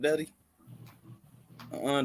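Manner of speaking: ordinary delivery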